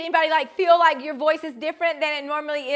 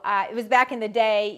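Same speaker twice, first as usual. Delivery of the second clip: normal talking voice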